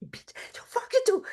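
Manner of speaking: mock whispered insult; whispered curse. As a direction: hiss the curse under your breath. Retelling her own curse.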